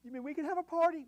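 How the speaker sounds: put-on high, quick child's voice; little boy's voice. A pastor voicing the boy, high and eager. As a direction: pitch up like an excited little boy. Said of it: "but he says".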